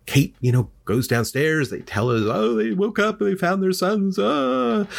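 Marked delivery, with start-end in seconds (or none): 2.2-4.9 high, hammed-up wail; mock wailing. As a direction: imitate a frantic parent wailing the news. Parents wail over dead sons.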